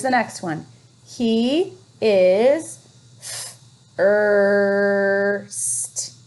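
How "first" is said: first drawn out, sound by sound; segmenting first. Shown as a demonstration. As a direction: stretch first slowly. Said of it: "sounding out the word".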